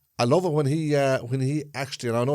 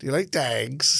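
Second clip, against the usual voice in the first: mock traveller accent, dogs said as dags; imitating the film's traveller line, dags with a flat a; traveller accent from Snatch; slower than usual. Quoting the Snatch traveller line with dags for dogs. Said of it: "That was actually all right".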